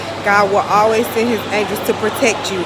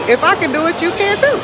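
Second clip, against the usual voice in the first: rising high and excited; 8 semitones higher than usual. Real, not acted. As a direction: voice climbs higher on each word. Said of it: "And now I'm telling you".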